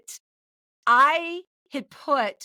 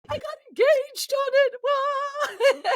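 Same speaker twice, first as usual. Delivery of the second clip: much higher and slow, a thrilled squeal; excited squeal; 4 dB louder, much slower than usual. Real, not acted. Telling the hosts about her engagement, giddy squeal. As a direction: gush it out, giddy.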